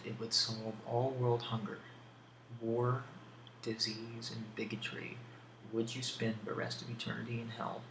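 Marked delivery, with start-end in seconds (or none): none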